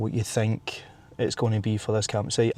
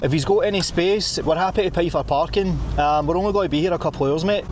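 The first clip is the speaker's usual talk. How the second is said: exaggerated Scottish, pitched higher; higher-pitched, hammed-up Scottish request; blunt Scottish accent; 6 semitones higher than usual. Shown as a demonstration. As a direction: play up the Scots, straight to the point. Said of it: "This is how Scottish do it".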